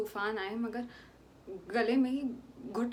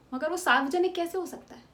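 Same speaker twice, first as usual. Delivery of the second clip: higher, louder protest; indignant outcry; 6 semitones higher, 6 dB louder than usual. Acted. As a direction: demand it of the whole room. Challenging the court.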